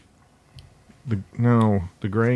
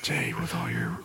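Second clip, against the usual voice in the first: hushed whisper, higher; 8 semitones higher, 8 dB quieter than usual. Real, not acted. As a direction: breathe it as a secret. Hushed scolding aside.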